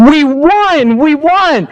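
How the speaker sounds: high, thrilled shout of victory; excited yell. Retold or imitated. Senator calling to say they won, shouting excitedly. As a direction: cry out the good news. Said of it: "and she's yelling".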